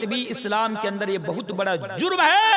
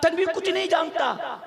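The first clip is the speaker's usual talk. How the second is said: mocking gossip; 7 semitones higher than usual. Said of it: "कुछ लोग कहते".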